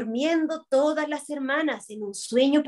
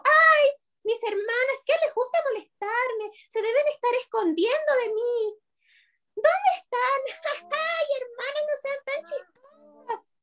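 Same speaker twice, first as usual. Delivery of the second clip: put-on very high, little-girl teasing voice; giggly playful sister; 11 semitones higher than usual. A narrator's reading. Little U thinking her sisters are hiding, playful high voice. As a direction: play along in a high, amused squeak. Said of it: "era tan risueña y era tan juguetona".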